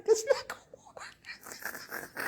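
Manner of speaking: high, slow whisper through a laugh. Real, not acted. Streamer telling the commenter it won't work, whispered and laughing. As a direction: breathe it out while giggling. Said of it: "but it's, it's not gonna work".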